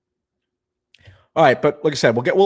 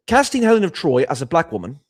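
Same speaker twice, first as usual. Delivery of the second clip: higher, louder mock British accent; 4 semitones higher, 5 dB louder than usual. Streamer reading a donor's message, British accent. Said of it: "I'll do it in a British accent".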